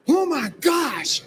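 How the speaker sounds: slow, high awed gasp; astonished gasp. Retold or imitated. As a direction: breathe it out in awe. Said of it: "Jerry turned around and went".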